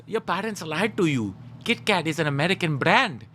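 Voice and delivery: imitated Indian kid correcting a rival; mock Indian accent. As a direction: put on an Indian accent to set them straight. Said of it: "the Indian kid came in and he goes".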